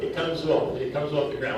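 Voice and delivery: it comes OFF, off punched with a falling tune; stress on off. Shown as a demonstration. Drum teacher to his students, hitting off. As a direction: lean hard on off to show the foot lifting. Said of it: "the foot has to come off the ground".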